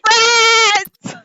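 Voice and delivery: high, wailing cry; acted grief. Old lady finding her friends dead, grieving wail. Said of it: "She goes".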